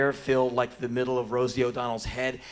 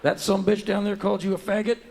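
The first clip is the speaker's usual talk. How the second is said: higher, louder Texas policeman voice; Texas cop; 7 semitones higher, 6 dB louder, faster than usual. Acted. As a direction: ask it as an indignant Texas lawman. Texas cop voiced asking in disbelief.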